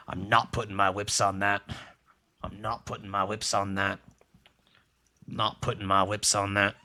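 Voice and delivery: lightly voiced game hero, pitched low; Full Throttle protagonist. Streamer imitating a game hero's stock refusal, lightly voiced and low. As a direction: say it low, as a hint of the biker hero. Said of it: "Full Throttle's protagonist is like".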